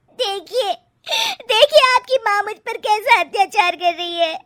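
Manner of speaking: a bride badly faking a sob to her husband; fake crying. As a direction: fake tears to win the husband. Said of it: "रोने का नाटक करने लगती".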